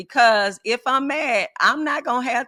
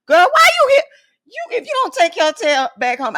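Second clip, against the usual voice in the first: loud, much higher incredulous scold; retold scolding; 10 semitones higher, 9 dB louder than usual. Mentor scolds a retreat member, loud and incredulous. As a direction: tell her off like she's lost her mind.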